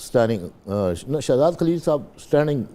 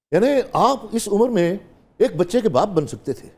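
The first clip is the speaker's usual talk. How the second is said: indignant retort, much higher and louder; a son defying his father in the drama, much higher and louder; defiant son; 10 semitones higher, 4 dB louder than usual. Acted. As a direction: throw the question back at your father. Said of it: "آپ نے کیا کہا؟".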